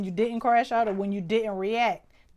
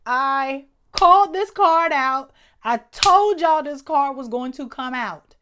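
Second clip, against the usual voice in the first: loud gloating; 5 semitones higher, 12 dB louder than usual. Real, not acted. Gloating over a predicted card.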